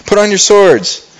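acted heated order; heated David. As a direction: order the men to arm. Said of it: "And David's really heated".

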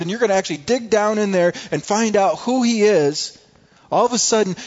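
rising to a loud, high preaching climax. Acted. Preacher warning the congregation about Satan, building to a loud climax.

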